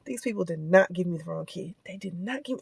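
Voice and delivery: low-volume disbelieving mutter; muttered denial. Retold or imitated. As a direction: talk yourself out of it quietly.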